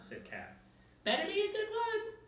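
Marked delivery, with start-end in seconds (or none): 1.0-2.1 put-on very high, loud child's shout; little girl shouting. A narrator's reading. A little girl shouting up at Kat. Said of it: "shouted a little girl".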